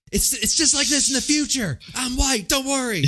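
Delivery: high-pitched, hammed-up reassurance; overplayed jokey protest; mock Reese. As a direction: plead it high, for laughs. Mock-voicing Reese reassuring the police.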